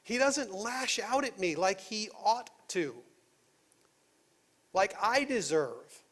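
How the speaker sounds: normal talking voice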